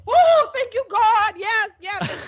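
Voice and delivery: high, loud thank-you to God; mock relieved praise. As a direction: throw it up like a grateful mom. Mother voiced, relieved joy.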